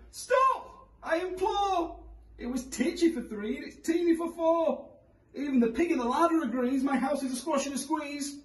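put-on high, desperate old woman; crying old lady. A narrator's reading. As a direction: cry out like a frantic granny. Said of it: "The little old lady cried".

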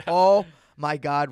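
slow, loud, drawn-out dismay; hammed-up sinking realization, drawn out; retold dread. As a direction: stretch each word in mock despair. Retelling dread during border questioning.

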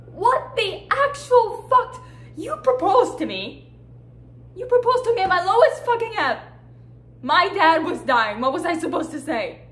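high, loud, furious outburst; outraged yelling. Acted. Wife hits back at husband over proposal, furious shouting. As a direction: yell it in outraged disbelief. Wife erupts in acted marital quarrel.

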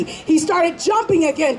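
usual speaking voice

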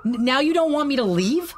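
can't believe what he's hearing; incredulous. Acted. Husband incredulous at her plea.

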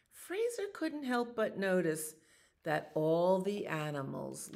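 animated picture-book narration; reading to children. A narrator's reading. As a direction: tell the story to little listeners. Reads picture book aloud.

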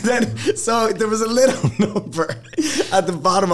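high, giggly storytelling; laughing retelling. Real, not acted. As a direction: keep talking through real laughter. Still laughing while telling the story.

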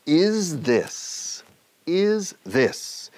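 "Is this" pulled apart, high-pitched "is"; slow teaching demo of "is this"; dissected slowly. Shown as a demonstration. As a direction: draw out "is" and "this" separately, high start. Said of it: "diseccionemos un poco".

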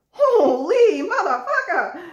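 high, slow whinnying laugh through the words; shocked at the tarot card, cursing through a horsey laugh; horse-like laughing exclaim. Real, not acted. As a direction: blurt it out laughing like a neighing horse. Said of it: "Sounded like a horse there".

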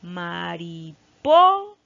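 "Mariposa" slowly, with PO loud and drawn out; correct stress on PO. Shown as a demonstration. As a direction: build to a big PO in "Mariposa", then drop on the last syllable. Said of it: "aquí se encuentra la sílaba".

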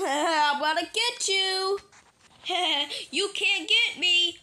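high, giggly chase teasing; sing-out gleeful pretend taunting; playful taunt. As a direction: taunt each other while playing tag. Toys tease in a chase game.

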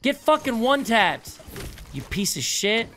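high loud gloating yell; taunting shout. Real, not acted. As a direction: scream the taunt in triumph. Taunting a killed enemy.

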